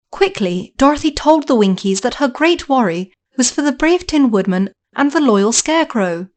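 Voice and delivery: plain reading voice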